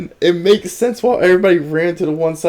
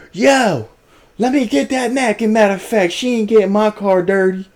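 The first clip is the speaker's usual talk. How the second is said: high-pitched mock yell; retold screaming; 7 semitones higher than usual. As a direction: imitate an agitated man calling out demands. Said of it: "Starts screaming even more".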